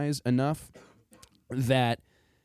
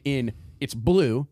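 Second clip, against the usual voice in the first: ordinary talk, nothing marked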